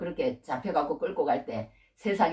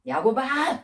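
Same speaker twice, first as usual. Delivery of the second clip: raised, sharp call of a name, as if scolding; mock scolding call; 7 semitones higher, 4 dB louder, much slower than usual. God hypothetically telling Jacob off, scolding call. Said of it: "그러면서 막 야단치시거나".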